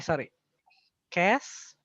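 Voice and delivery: ordinary talk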